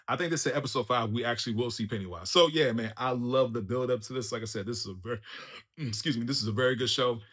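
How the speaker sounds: normal talking voice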